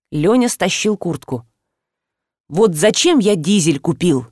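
plain reading voice

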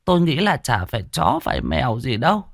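grandmother ominous. A narrator's reading. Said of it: "Bà nội ngồi bên hiên cũng nói xen vào".

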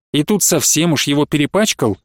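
plain reading voice

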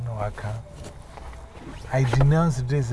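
ordinary talk, nothing marked

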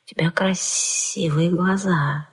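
hissing reptile growl. A narrator's reading. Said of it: "проворчал он".

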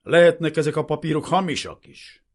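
loud, high accusing retort; bullying, contemptuous challenge; hostile suspicion. A narrator's reading. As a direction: cast doubt aggressively. Hostile lieutenant doubts the papers.

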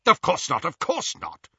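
high, hurried brush-off; impatient. A narrator's reading. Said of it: "said Lucius impatiently".

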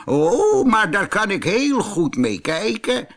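put-on high, cheerful old ape voice; old ape replying. A narrator's reading. Old ape answering the lion, high cheerful voice. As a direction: brush off the jab lightly. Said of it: "zei de oude aap".

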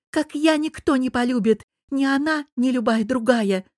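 high-pitched impassioned outburst; blurted out. A narrator's reading. Rejected Anya insists on her love, blurting it out. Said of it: "выпалила Аня".

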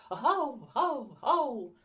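bass barking. Acted. Dog joining the wake-up call, loud barking. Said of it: "басовито вторив Бровко".

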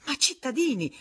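high, loud interjection; protesting. Acted. Federico trying to be heard over the Spaniard, high and loud. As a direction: cut in urgently.

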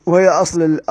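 ordinary talk, nothing marked

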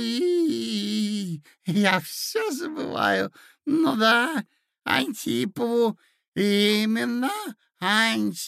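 put-on high, quavery old man voice; high-pitched senile old prince; doddering old prince.

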